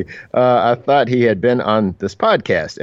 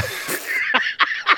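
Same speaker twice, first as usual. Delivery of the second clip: a burst of high unvoiced laughter; high squealing laugh; 4 dB quieter than usual. Real, not acted. Cohost laughs high and breathy at his own bad English accent.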